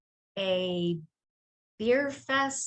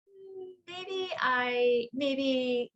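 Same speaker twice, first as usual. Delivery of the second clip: drawn-out, higher-pitched musing; mock wavering, stretched out; hesitant self-doubt; 4 semitones higher, much slower than usual. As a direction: imitate yourself dithering, very slowly. Retold self-doubt after a setback.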